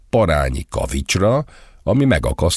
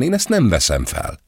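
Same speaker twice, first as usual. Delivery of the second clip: mild snobbish refusal; 7 semitones higher than usual. A narrator's reading. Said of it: "pont annyira flegma".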